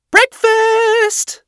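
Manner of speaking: high, loud singsong call, drawn out; cheery announcement. A narrator's reading. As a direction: sing it out brightly on entering. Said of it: "announced cheerily".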